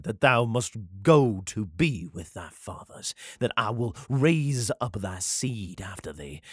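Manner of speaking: slow, drawn-out Southern scripture reading; Southern God. Acted. God promising David a kingdom, slow Southern accent. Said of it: "I think I'll run with it for a while".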